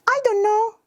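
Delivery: put-on high-pitched kid who doesn't know; small, high child voice; little child. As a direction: answer like an unsure little kid. Child answering therapist in role-play.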